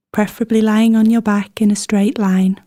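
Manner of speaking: ordinary delivery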